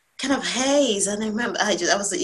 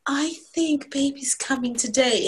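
slow, carefully restrained announcement; non-excited voice; 5 semitones higher, slower than usual. Retold or imitated. Wife telling husband baby is coming, deliberately non-excited. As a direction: hold the excitement in, stretching the words out. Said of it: "in a very non-excited voice".

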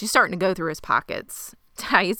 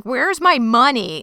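demanding; 6 semitones higher, 6 dB louder, slower than usual. Retold or imitated. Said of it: "She's like".

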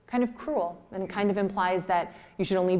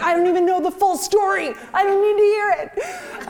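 high, loud yelled dismissal; exasperated cop; 13 semitones higher, 7 dB louder than usual. Retold or imitated. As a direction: shout it back like a fed-up cop. Exasperated cop, retold shouting back.

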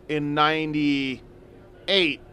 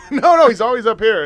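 laughing; 6 semitones higher, 8 dB louder than usual. Real, not acted. Laughing about the boss's office.

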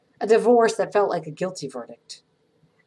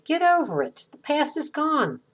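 dismissive brush-off; 9 semitones higher than usual. Retold or imitated. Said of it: "Those who've never landed ... will say".

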